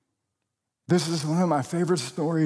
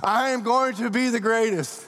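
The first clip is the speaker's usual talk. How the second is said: mocking proud boast; 5 semitones higher than usual. Preacher mocking the disciples' boast in a puffed-up voice.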